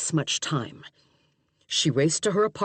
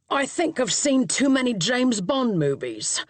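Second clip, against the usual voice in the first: higher Cockney cabbie voice; Cockney accent; 6 semitones higher than usual. A narrator's reading. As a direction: East End cab driver. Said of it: "heavy Cockney accent".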